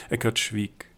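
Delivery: plain reading voice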